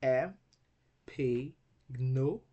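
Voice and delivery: E, P, gno as separate beats with long pauses; isolated, deliberate E, P, gno; syllable by syllable. Shown as a demonstration. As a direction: break E, P, gno apart like a teacher counting syllables. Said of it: "has four syllables".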